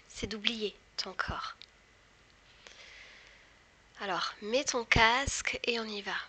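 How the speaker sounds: slow whispered guided hypnosis. Acted. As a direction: whisper slowly, guiding into trance. Leads a hypnosis induction.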